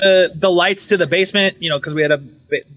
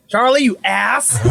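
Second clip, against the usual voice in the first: mock scolding; 5 semitones higher, slower than usual. Acts out scolding the ghost.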